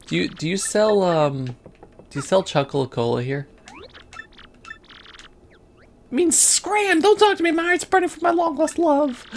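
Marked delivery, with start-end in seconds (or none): 5.8-9.3 much higher dramatic lovestruck voice; high, louder melodramatic lover; lovesick character voice. Acted. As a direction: shoo them off, heartbroken and high.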